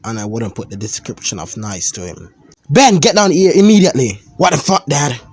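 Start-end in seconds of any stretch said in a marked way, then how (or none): 2.7-4.2 loud, high stern yell down the stairs; furious father calling his son; angry father shout. Acted. Uncle yelling for son. 4.4-5.2 put-on teen talking back. Son snaps back at dad.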